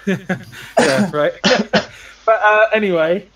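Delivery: laughing through the words; breaking up with laughter. Real, not acted. Laughing fit on stream.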